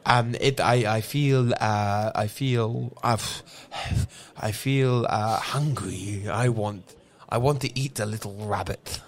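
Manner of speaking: a groggy creature struggling for words; dazed half werewolf. Acted. Half werewolf Larry tells his friends he's hungry, low and halting.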